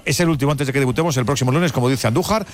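ordinary delivery

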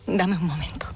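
hushed grieving whisper; susurro dolido. Acted. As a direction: whisper it, asking for a moment at the funeral. Grieving mother at son's funeral. (susurro apenado, casi sin voz)

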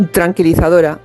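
ordinary talk

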